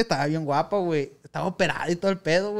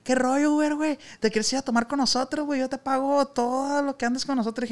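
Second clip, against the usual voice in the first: partying guy; 8 semitones higher than usual. Retold or imitated. Partying guy inviting the driver.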